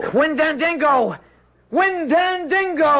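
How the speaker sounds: frantic summoning call; desperate calling. Acted. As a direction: cry the name out as if summoning help.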